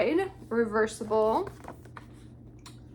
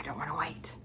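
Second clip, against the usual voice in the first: whispered; 6 semitones lower, 9 dB quieter, much faster than usual. Retold or imitated. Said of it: "So I was like".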